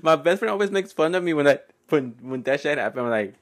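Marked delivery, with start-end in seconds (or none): none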